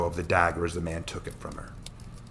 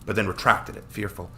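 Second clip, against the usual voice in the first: ordinary delivery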